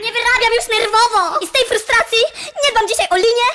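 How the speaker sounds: frustrated comic dachshund, pitch jumping up in complaint; aggrieved dachshund. Acted. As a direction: whine like a washed-up dachshund celebrity.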